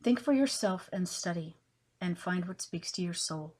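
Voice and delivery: measured poetry reading with long pauses between phrases; poem recitation. Acted. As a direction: recite it line by line, punching openings, letting endings settle. Reads her own poem aloud.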